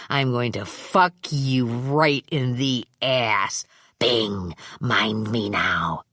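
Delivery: low, slow, gloating threat from a crude thug; menacing thug. Acted. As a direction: drag out every threat, enjoying it. Gas mask man voiced.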